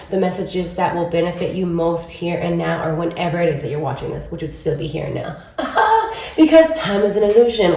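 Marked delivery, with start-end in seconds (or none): 5.6-6.3 a quick high giggle; short giggle. Real, not acted. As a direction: giggle at your own joke. Laughs at her own joke.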